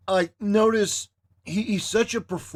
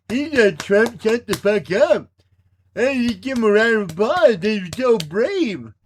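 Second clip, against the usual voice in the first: mock admiring fan; 2 semitones higher, 4 dB louder than usual. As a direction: gush sarcastically, stretching the words. Mocking the cheering audience.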